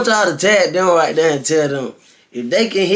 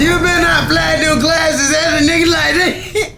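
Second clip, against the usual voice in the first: higher, laughing excited call-out; hyped; 7 semitones higher than usual. Real, not acted. Hyped up at the dancer's glasses flip, laughing shout. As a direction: yell it out laughing at the move.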